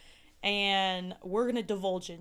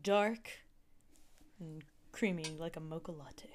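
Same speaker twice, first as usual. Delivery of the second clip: low sultry whisper; 3 semitones lower, 5 dB quieter than usual. Acted. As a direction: whisper it teasingly. Teasing sultry build-up.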